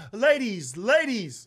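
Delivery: high, loud crowd-working call; imitating hype comic. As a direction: hail the audience like an overeager comic. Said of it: "everybody come on stage and be like".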